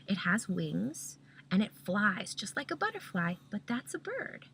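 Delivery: put-on gentle hushed explanation to a child; soft whispered correction. Shown as a demonstration. Parent gently correcting daughter, softly hushed and tender. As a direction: correct her softly, barely voiced. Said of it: "You say".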